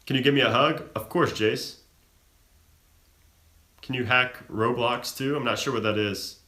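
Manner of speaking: ordinary delivery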